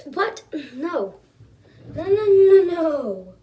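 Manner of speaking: high, loud burst of refusals; hammed-up incredulous outburst; disbelieving denial. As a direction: act out shocked denial, climbing high. Acted refusal to believe drill.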